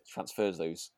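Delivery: ordinary delivery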